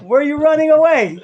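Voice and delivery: high, loud call across the street. Retold or imitated. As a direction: call out loud after someone running away. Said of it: "the rabbi screams to him".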